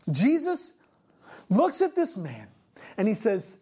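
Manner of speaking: ordinary talk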